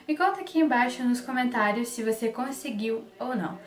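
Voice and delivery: ordinary delivery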